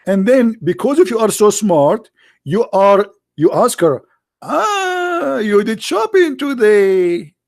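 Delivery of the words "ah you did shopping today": mock dim husband. Mocking clueless husband line.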